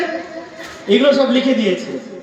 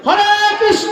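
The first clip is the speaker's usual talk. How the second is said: shouted high হরে Krishna; very loud; 10 semitones higher than usual. Shown as a demonstration. Preacher wants the chant heard past the gate, shouting. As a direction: shout it so the street hears. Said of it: "খুব জোরে".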